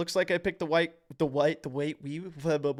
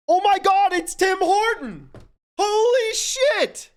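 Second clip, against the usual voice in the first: high, loud shriek of discovery; shrieking with delight at a sudden breakthrough; excited shout; 7 dB louder than usual. Real, not acted. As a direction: yell it out like you just cracked the puzzle. Finds the answer in the game.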